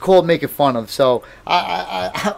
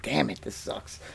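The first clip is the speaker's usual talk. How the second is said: low, much softer grumble under the breath; muttered complaint; 6 semitones lower, 15 dB quieter than usual. Retold or imitated. Muttering to himself about grabbing girly toys. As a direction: grumble it to yourself, defeated. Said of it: "I'm like".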